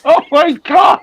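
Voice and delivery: high, loud cry through laughter; laughing outcry. Real, not acted. Host reacts to the Cockney disclaimer, shrieking with laughter. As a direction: shriek it, cracking up.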